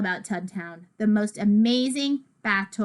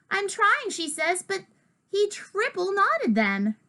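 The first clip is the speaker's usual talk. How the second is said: put-on higher, straining kid voice; strained protest; 10 semitones higher than usual. Rosabelle struggling with knots, strained higher voice. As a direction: protest while struggling, pitched up.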